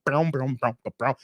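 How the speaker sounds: low plucked-string mouth noises; low vocal bass riff; imitated bass. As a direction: boom out bass notes with your mouth. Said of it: "That's the bass, y'all".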